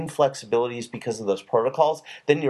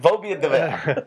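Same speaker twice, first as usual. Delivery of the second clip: slow, lightly put-on French accent; drawn-out playful French; mock French; 3 semitones higher, slower than usual. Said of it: "great Rachel when pronounced with a perfect French accent".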